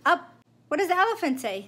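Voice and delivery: high, quick playful question. Real, not acted. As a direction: quiz a little one playfully. Quizzing toddler, fast and high.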